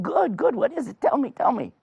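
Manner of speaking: high, excited urging; high-pitched acted impatience; eager king. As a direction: press eagerly for the secret.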